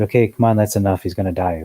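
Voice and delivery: ordinary talk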